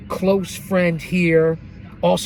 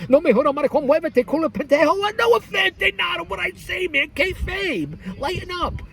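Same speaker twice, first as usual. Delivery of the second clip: high-pitched mock rant, sliding into Spanish; mock outburst; 12 semitones higher than usual. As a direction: fire it off way up high, playfully put-on. Mock Spanish outburst, then kayfabe excuse.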